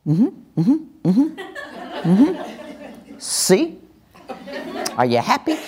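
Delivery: high, knowing mm-hmm with a laugh; accusing tag. Retold or imitated. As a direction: rub it in. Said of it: "we usually tag it".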